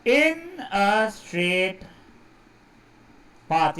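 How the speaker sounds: very slow, word-by-word dictation; deliberate, with gaps between words. Real, not acted. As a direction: stretch the phrase out as if writing. Writing an addition to the definition.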